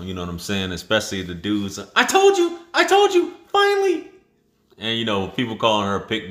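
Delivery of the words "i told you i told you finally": very high, loud mock-gloating squeal; gloating guys. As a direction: gloat like a dude who called it. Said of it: "rubbing their hands together like [name]".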